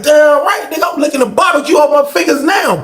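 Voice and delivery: high, shouted gloat; acted calling out. Inmate answering neighbor about his chicken, shouted gloat. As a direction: holler back boastfully to the next cell.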